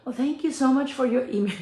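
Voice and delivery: a hint of a polite service voice; lightly sweet, professional politeness; customer service voice. Replying to the customer, lightly polite service voice. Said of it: "in my customer service voice".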